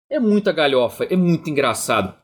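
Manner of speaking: ordinary talk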